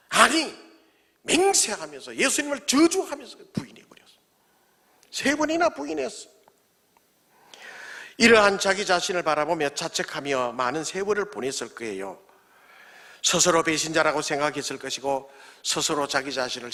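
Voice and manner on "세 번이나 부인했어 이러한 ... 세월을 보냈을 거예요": normal talking voice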